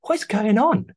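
high, loud bewildered cry; baffled surprise. Retold or imitated. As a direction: squeal it in disbelief. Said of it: "I was like".

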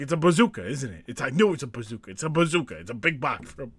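ordinary talk, nothing marked

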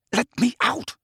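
high, held-in anger; tense demand. A narrator's reading. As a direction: demand it through barely held rage. Harry shaking, demands to leave.

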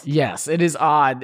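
ordinary talk

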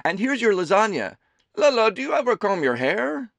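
high, loud doting mother voice; a fussing mother teasing her son; Amelie's voice. A narrator's reading. Mother serving her son lunch, high and fussing.

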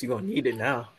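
voice crack. Real, not acted. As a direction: voice cracking mid-line. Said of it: "Ignore my voice crack".

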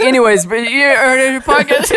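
laughing replay. Retold or imitated. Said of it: "It was like".

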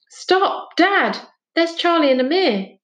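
high urgent stop dad; urgent call. A narrator's reading. Boy calling his dad to stop the van, urgent and high. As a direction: shout for the van to halt.

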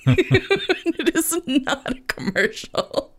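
high, slow, laughing through it; giggly disclaimer. Real, not acted. Host laughing as she says it is not an ad. Laughing denial after joke.

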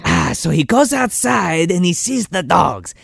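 slow, put-on Lambert-style accent; Christopher Lambert accent. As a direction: keep narrating in the Lambert accent. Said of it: "you Christopher Lambert?".

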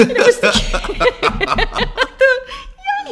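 high, loud gushing through laughter; can barely get it out for giggling; laughing. Real, not acted. Telling co-host the cute dog story, laughing.

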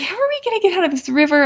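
high, fast panicky cry of a mother in the river; panicked retelling. Retold or imitated. Mother stuck in a river with her son, panicked and high. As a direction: blurt it out in a rush of retold panic. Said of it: "I was kind of panicked".

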